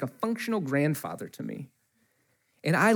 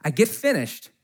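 ordinary talk, nothing marked

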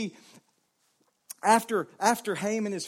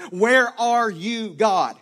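drawn-out, louder plea, each word stretched; slow anguished cry; 7 dB louder, much slower than usual. Acted. Pastor repeating the believer's question to God, slowed and drawn out. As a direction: let the question hang, slow and aching.

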